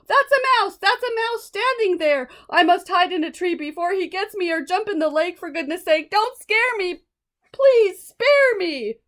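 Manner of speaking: high, panicked plea; frightened pleading. Acted. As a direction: elephant pleads with the mouse. Elephant panics at mouse.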